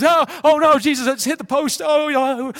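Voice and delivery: high hammed-up crowd gasps and relief; mock crowd reaction. Preacher imitating a hockey crowd at a near miss, hammed-up gasps. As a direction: play the whole arena groaning then cheering. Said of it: "and the crowd goes".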